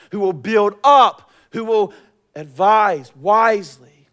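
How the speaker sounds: loud, high, building sermon cadence; full-force preaching. Acted. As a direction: proclaim God's promise with driving force. Preaches God's words at full force.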